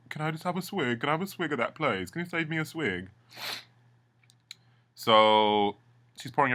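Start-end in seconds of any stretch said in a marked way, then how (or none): 0.1-3.1 wheedling beg. Retold or imitated. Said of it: "he's like".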